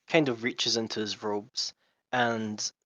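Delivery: usual speaking voice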